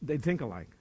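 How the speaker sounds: usual speaking voice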